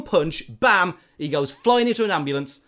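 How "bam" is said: ordinary talk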